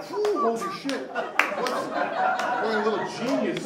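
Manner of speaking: high, loud and amazed, drawn out at the end; mock awe, voice jumping way up and slowing down; amazed gasp. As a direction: gush like you just found a prodigy. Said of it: "you're like".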